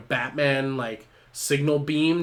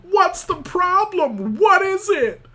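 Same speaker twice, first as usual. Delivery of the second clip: much higher, louder, slow hammed-up Batman; a silly over-the-top Batman growl pitched way up; 9 dB louder, slower than usual. Batman arriving at the dumpster fire, hammed-up silly voice. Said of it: "Batman rolls up and he's like".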